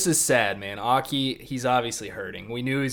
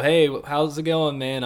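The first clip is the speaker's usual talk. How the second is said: casual greeting; 4 semitones higher, 7 dB louder than usual. Retold or imitated. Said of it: "what if you just go".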